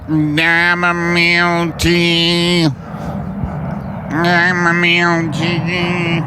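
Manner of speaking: slow, drawn-out chamomile tea; ad slogan voice. Shown as a demonstration. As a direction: purr the slogan like an ad. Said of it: "That's the, uh, slogan now".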